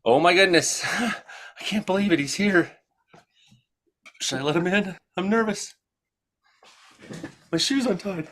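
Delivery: hammed-up excited nervousness; mock nervous fan voice. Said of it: "I better not talk like that".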